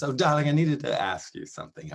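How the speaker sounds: put-on lightly affected dandy accent for a courteous request; Dandy's polite accent. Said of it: "I will do the accent".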